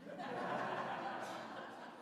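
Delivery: quiet airy laugh under his breath; soft breathy laugh. Real, not acted. Laughing softly at his fear of empty farmland. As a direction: let a soft unvoiced chuckle out.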